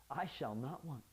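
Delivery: unconvinced, trailing off quietly; half-hearted. Shown as a demonstration. Preacher demoing faked faith, hesitant and unsure. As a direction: say it like you're faking it. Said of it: "This is what we call fake it till you make it".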